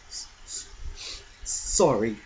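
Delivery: stammering through a light sob; a touch of sobbing; mild sob. Acted. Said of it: "sobbed Hagrid".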